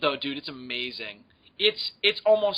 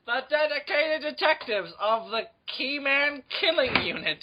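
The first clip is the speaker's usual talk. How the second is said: announcer voice; 10 semitones higher than usual. Acted. Mock Law and Order narration.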